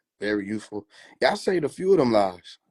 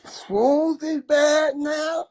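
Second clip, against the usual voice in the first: much higher, very slow mocking voice; mocking people; much slower than usual. Mocking people who say shrooms are bad, slow and high. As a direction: drag it out to mock the critics. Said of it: "Y'all got people out here saying".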